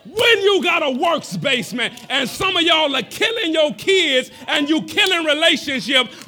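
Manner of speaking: loud, high shouted sermon; shouted preaching. Acted. Preaching at full force.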